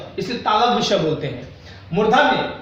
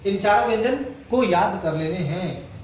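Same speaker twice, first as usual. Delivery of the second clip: ordinary delivery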